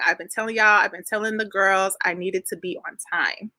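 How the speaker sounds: ordinary talk, nothing marked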